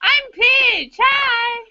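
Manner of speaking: put-on high little gremlin child voice; squeaky gremlin kid; giggly sneering imp. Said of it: "make him sound like a little gremlin child".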